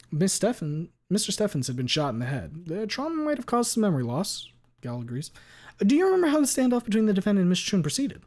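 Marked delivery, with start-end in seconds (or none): 0.1-4.5 higher, louder lawyer question. Acted. Lawyer explains to court. 5.8-8.2 higher, louder lawyer question. Acted.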